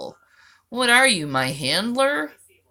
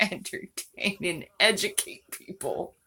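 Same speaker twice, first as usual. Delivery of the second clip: whispered; 8 dB quieter than usual. Pretend. Sarcastic whispered ending.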